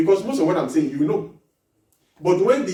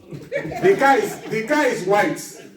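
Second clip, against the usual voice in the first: mock blunt man; 8 semitones higher, 4 dB louder, slower than usual.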